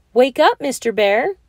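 wife raising her voice at a sleeper. A narrator's reading. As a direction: shake him awake with your voice. Shaking him harder.

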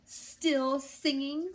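irritated mayor snapping at a rooster. A narrator's reading. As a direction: snap the question like an annoyed official. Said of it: "snapped Don Pepe".

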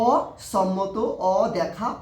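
অসম্মত drawn out very slowly, each syllable apart; teacher spelling out অসম্মত in slow syllables. Shown as a demonstration. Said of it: "আমি একটু সিলেবলটা".

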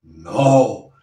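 ordinary delivery

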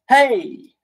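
bright shout, up high; shouted hey. Shown as a demonstration. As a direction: call out the hey. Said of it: "you just shout, "Hey".

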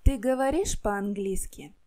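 ordinary talk

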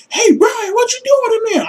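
nosy family member calling into his room; mock intruder voice. Someone bursting in, calling to Brian in high mock voice.

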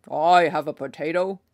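lightly voiced villager calling from the back; deep voice calling out. Villager at the back of the crowd calling out, lightly voiced and loud. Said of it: "A deep voice in the back of the crowd called out".

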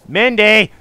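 loud, high shout of a name; calling out. Pretend. Player calling slow Mindy, impatient shout. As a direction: holler for her to come. Calling dawdling game character.

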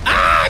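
short low loud startled ah; startled cry. Real, not acted. Gamer caught by another player, startled cry. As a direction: caught off guard, yelp.

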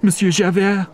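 high, pleading woman's voice. Acted. Fantine pleading with Javert not to jail her, high and desperate. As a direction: beg for mercy in a high voice.

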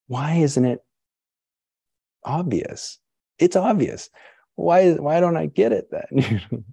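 high, exasperated pleading, slowing down. Acted. Teacher acting her own seeking mind, high comic exasperation. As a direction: wail in comic frustration at yourself. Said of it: "This is the part that just kills me".

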